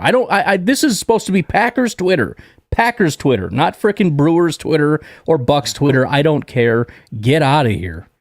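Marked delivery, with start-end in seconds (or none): none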